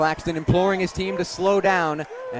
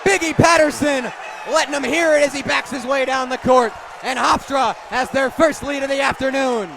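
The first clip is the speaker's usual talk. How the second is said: excited call; 6 semitones higher than usual. Real, not acted. Announcer calls the first home lead for the crowd, elated. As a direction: explode on the make and ride the roar.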